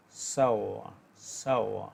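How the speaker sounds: "সাওয়া" with the r almost silent; pronunciation demo. Shown as a demonstration. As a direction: say "সাওয়া", dropping the r. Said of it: "র এর উচ্চারণ হবে শতভাগের মধ্যে দশ ভাগ".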